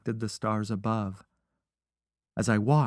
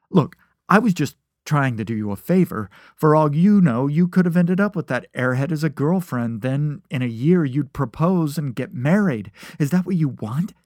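loud, higher-pitched, urgent rattle; hurried pleading; 5 semitones higher, 6 dB louder than usual. A narrator's reading. Friend dragged by lapels justifies himself, hurriedly. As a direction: argue your case in a rush. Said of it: "He started speaking faster".

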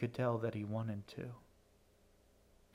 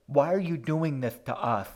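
loud, higher-pitched struggle to form words; labored gap-toothed speech; 4 semitones higher, 11 dB louder than usual. A narrator's reading. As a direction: force it out through missing front teeth. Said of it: "fought to shape the words, forcing them through the awkward gap".

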